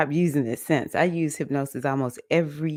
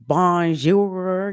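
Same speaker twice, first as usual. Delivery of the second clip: "bonjour" said with a heavy Southern United States drawl; a Southern American tourist saying "bonjour"; Southern US accent; 4 semitones higher, 5 dB louder than usual. Retold or imitated. Mocking how a Southern American says "bonjour", in a Southern US accent. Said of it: "like a Southern United States person".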